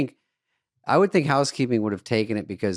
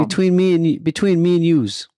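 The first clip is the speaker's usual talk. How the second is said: higher, louder Goomba bluster; mock mob wiseguy; 7 semitones higher, 6 dB louder than usual. Podcast host riffing as the Vegas goon, loud mock menace. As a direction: play the hotel goon leaning in.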